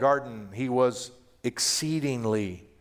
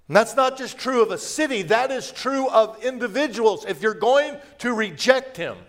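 raised, higher, urgent preacher voice; fervent preaching; 8 semitones higher, 4 dB louder than usual. Acted. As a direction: drive the warning home. Preaching at full force.

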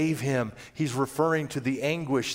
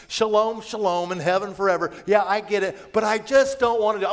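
higher, casual dismissive sinner voice; mock rejecter; 6 semitones higher, 3 dB louder than usual. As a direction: brush off the offer lightly. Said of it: "every single person who says".

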